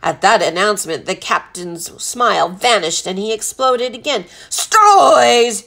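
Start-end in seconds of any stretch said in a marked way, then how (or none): 4.5-5.6 long, loud explosive roar; thundering captain in fury; roared outrage. A narrator's reading. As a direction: explode on the word, drawn out. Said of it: "he roared".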